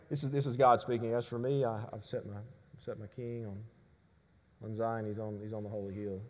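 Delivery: soft, meek and limp, no force at all; weak reading. Shown as a demonstration. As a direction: read it timidly as if it meant little. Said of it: "We can't read this and go".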